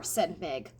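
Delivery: ordinary delivery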